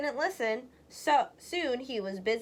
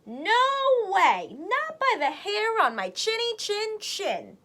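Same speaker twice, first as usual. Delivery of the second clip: higher, louder piggy refusal; little pig crying; 9 semitones higher, 5 dB louder than usual. Acted. Second pig refuses the wolf, higher and louder. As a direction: squeal it back defiantly. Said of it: "cried the second little pig".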